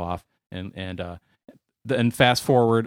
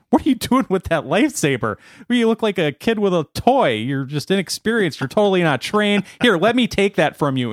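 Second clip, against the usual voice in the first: mocking Vader; 7 semitones higher than usual. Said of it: "He's like".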